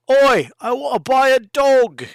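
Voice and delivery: put-on high, loud cheeky Cockney accent; brash Cockney shopper calling out. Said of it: "bad Cockney accent".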